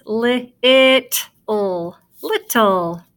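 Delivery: very slow "li-it-le" in pieces, then "Little" whole; sounding out. Shown as a demonstration. Teacher introducing a word to pupils, sounding out. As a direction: stretch "li-it-le" apart, then blend it into "Little". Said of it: "we have two T's and two L's".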